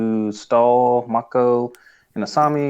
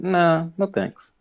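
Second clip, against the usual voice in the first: higher, slow mock refusal; casual brush-off, pitched up; dismissive Bolin; 6 semitones higher, slower than usual. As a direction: draw out a high, careless no. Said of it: "And then he's like".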